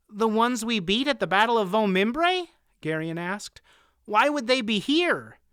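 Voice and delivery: put-on boy voice. A narrator's reading. Boy asks questions.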